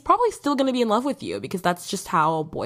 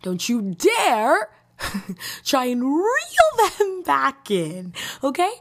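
slow, higher, louder don't you dare, laughing through it; mock stern warning; 6 semitones higher, 3 dB louder, slower than usual. Real, not acted. Vlogger warning viewers off their exes, slow mock stern. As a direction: draw out the threat playfully.

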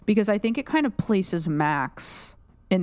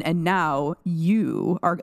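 very slow, each word drawn out; Billy; much slower than usual. Retold or imitated. Co-host imitating how Billy enunciates, slow and deliberate. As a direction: stretch out every word deliberately, like the possessed villain. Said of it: "enunciates each syllable".

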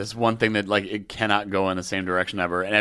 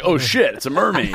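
high, loud gasp of wonder; mock amazed; 6 semitones higher, 4 dB louder than usual. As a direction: gawk like a yokel. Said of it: "It's like".

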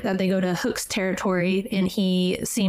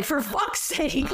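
exasperated groan; 5 semitones higher, slower than usual. Retold or imitated. Vane exasperated.

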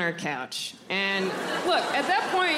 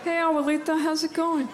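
forced cheer; 7 semitones higher than usual. Retold or imitated. Greeting grandma with forced cheer.

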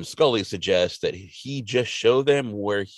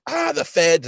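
alarmed; 6 semitones higher than usual. Retold or imitated. Moe spots Mulder watching.

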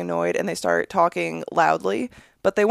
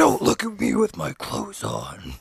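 low mock plea; 12 semitones lower, slower than usual. Joking plea in public.

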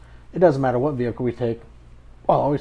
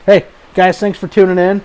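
podcast outro; 4 semitones higher, 7 dB louder than usual. Acted. Host signs off outro.

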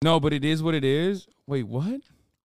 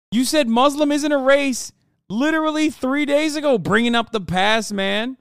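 furious high yell; angry yelling; 9 semitones higher, 5 dB louder than usual. Real, not acted. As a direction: shout him down, outraged. Yelling at caller about the past.

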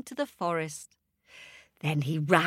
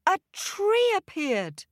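plain reading voice

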